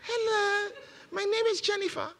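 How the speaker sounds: mock a man playing a woman, high and prissy; mocking a man acting as a woman. Preacher mocks a man who thinks he's a woman, high put-on voice. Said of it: "walking around like this".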